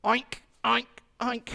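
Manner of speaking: loud, high mock oink oink oink; pig oinking. Pig shows the chick how to make a noise, loud mock oinking. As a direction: ham up a pig's oinks for the children. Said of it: "pig gets up there and says".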